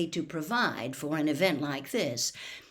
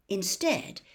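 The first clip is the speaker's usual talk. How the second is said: ordinary delivery, nothing marked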